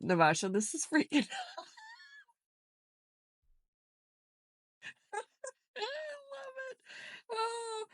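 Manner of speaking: high squealing laugh-cry; laughing till it breaks into a squeal; laughing fit. Real, not acted. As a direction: dissolve into helpless laughter.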